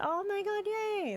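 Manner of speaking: excited. Real, not acted. Happy news from chat.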